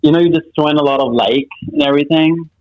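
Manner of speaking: put-on valley girl accent. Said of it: "hit me with a Valley Girl accent".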